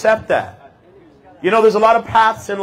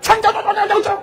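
mock Chinese chatter; faster than usual. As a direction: mimic the airport crowd's chatter, high-pitched. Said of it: "It was like".

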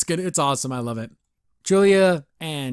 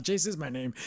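imitating a self-satisfied chuckle, then a soft boast; mock proud; 9 dB quieter than usual. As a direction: chuckle proudly while introducing yourself, hushed. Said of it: "he's, like, super proud of it".

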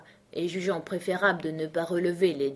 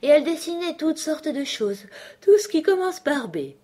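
high, louder character voice; sleepy dormouse; 10 semitones higher, 5 dB louder than usual. A narrator's reading. Dormouse listing what the sisters drew, high-pitched. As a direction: tell it in a high little voice. Sleepy Loir continues his tale.

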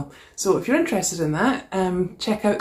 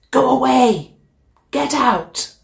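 loud, higher roar to scare it off; monster shout; 7 semitones higher, 5 dB louder, slower than usual. Acted. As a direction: bellow to chase the dragon. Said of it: "hears a horrible shout".

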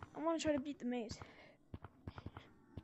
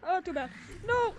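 being chased; 5 semitones higher, 6 dB louder than usual. Real, not acted. Caught while being chased.

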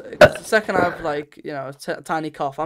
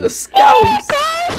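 very high, loud mock Scouse screech; Scouse accent; 10 dB louder than usual. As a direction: screech it as an over-the-top Scouser. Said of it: "if that was just Scouse accent".

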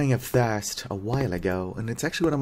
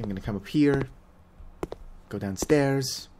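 ordinary talk, nothing marked

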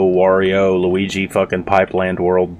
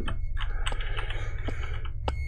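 long breathy exhale; weary sigh; 12 dB quieter than usual. Real, not acted. Gamer stuck on the puzzle, weary sigh.

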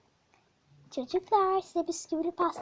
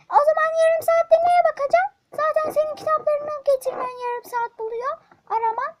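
furious mother; 11 semitones higher, 10 dB louder than usual. Acted. Mother scolds son.

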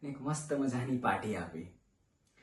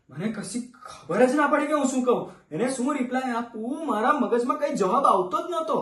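higher-pitched flustered recounting; acted panic; 6 semitones higher, 4 dB louder than usual. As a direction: voice lifts as the mind goes blank. Pregnancy news, mind goes blank.

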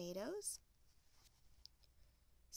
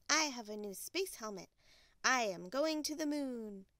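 eager Little Bear; 3 semitones higher, 6 dB louder than usual. Acted. Little Bear tells Mother Bear his moon plan, eager. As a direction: announce it boldly, with childlike excitement.

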